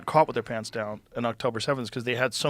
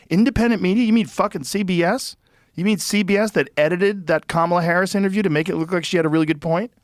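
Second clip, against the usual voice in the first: raised pitch, indignant rhetorical questions; scoffing, higher-pitched outrage; incredulous challenge; 6 semitones higher, 4 dB louder than usual. Real, not acted. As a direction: throw the claim back in disbelief. Mocking a politician's claim, incredulous.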